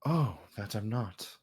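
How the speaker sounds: low, soft aside to his knight; understated, haughty king with pauses; lightly voiced king, offhand. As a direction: confide quietly as a relaxed ruler. Game master voices the king.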